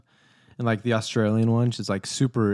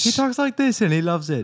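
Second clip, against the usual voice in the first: high, put-on British accent; Russell Brand; 6 semitones higher than usual. Co-host copying the comedian impression, high British accent. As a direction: imitate a British comedian, pitched up. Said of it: "No, Russell Brand is".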